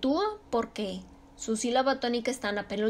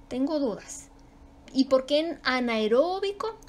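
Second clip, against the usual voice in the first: ordinary delivery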